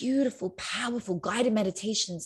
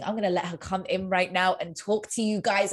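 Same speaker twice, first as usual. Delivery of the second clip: ordinary talk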